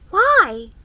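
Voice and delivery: put-on high, loud amazed girl; a girl amazed that no one missed her. A narrator's reading. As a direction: sound astonished. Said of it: "in amazement".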